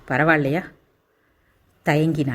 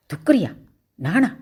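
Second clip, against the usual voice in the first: indignant shout; 4 semitones higher than usual. A narrator's reading. Girl shouts at the pilot's insult, outraged. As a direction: throw the insult back, shocked. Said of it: "என்று அவள் கத்த".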